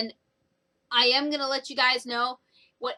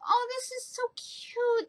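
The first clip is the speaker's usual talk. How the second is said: high, slow gushing coo; gushing over cute; 13 semitones higher than usual. Retold or imitated. Reviewer gushing over brothers getting dressed, high and slow. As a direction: coo it like something adorable. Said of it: "I was like".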